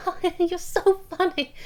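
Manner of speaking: high forced networking laugh; fake laugh. Shown as a demonstration. Coach mocks people laughing falsely at networking. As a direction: laugh falsely at a bad joke. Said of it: "That looks false".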